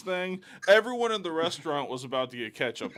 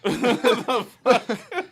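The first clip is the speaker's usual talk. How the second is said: laughing disbelief; 8 semitones higher, 7 dB louder than usual. Retold or imitated. His reaction to cashier's outburst.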